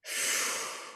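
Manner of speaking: soft voiceless sigh; cleansing exhale. Shown as a demonstration. Guide breathing along with the group, soft airy exhale. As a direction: let the breath rush out without voice. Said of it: "take a couple of cleansing breaths".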